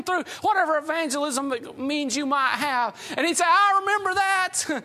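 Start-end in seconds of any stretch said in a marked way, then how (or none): 3.5-4.8 excited. Acted. Moody recognizes the gospel.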